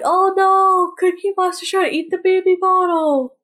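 high, playful mock panic; mock alarm. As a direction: act out a silly alarmed cry. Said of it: "I'm like".

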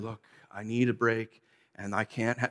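the soft version he pretends he used; calm reasonable voice. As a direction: talk it down calmly like a patient husband. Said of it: "No, that's not how I dealt with it".

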